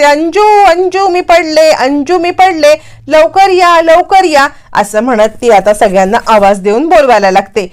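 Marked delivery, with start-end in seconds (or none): 0.0-4.5 higher, hammed-up calling out for help; milk a pretend fall, calling everyone over; fake fall cry for help. Woman faking a fall calls the family, hammed-up cries. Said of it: "पडल्याचं नाटक करत मोठ्याने ओरडायला".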